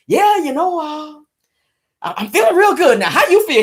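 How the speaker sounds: high, put-on voice of a pleased lover; mock smug man. Mocking the man asking how she feels, smug voice. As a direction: ham up his smug afterglow. Imitating the man's satisfied voice.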